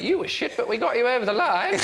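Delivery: high, laughing mock-royal put-down; playful Queen voice; translating the Queen.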